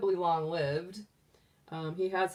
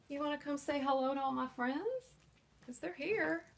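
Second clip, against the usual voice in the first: coaxing pet talk; 7 semitones higher, 4 dB quieter than usual. Real, not acted. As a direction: gently coax the bunny to come along. Coaxing pet bunny in baby talk.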